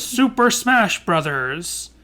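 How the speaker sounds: slow, drawn-out video game announcer. Retold or imitated. Host imitating the guy when the game starts, slow announcer delivery. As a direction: announce the title slowly like a game's intro. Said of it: "and the guy goes".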